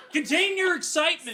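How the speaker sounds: laughing. Real, not acted. Player laughing in character.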